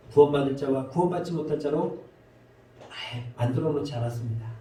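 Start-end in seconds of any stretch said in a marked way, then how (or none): none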